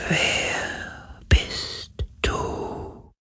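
very slow breath of a whisper, barely audible; faintest whisper. A narrator's reading. The wind asks Galen who he is, in the faintest whisper. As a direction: whisper each word drawn out, like wind. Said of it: "so leise wie der Schatten des Hauchs eines Flüsterns".

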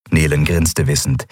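ordinary delivery, nothing marked

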